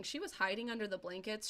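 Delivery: normal talking voice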